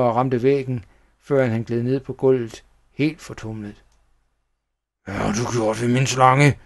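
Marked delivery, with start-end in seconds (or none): 5.0-6.6 furious villain voice, rising in force; angry yell. A narrator's reading. Schnickels confronting the child about his snake, angry yell. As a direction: yell it accusingly into their face. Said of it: "skreg han mig i ansigtet".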